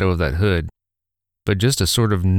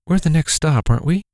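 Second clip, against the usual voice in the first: higher, uneasy mother asking; anxious mother voice; 8 semitones higher than usual. Acted. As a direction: ask hoping for a yes. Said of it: "the mother asked".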